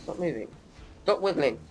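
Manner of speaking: normal talking voice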